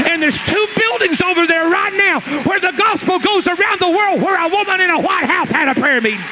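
Southern preacher hitting his peak; full-force preaching. Acted. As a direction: build it to a shouting crescendo for the church. Preaching climax, rising to shouts.

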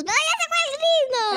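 high, loud dismayed cry. Real, not acted. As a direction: cry out as the friend leaves. La niña reacciona en el juego. (grito de decepción agudo)

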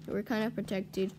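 normal talking voice

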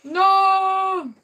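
high, loud cry of dismay; a sharp, sudden, loud "No!" as the run is lost. Real, not acted. Player dies just short of goal.

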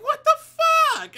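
hammed-up shriek of shock at the breakup note; shocked outburst. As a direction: blurt it out in disbelief.

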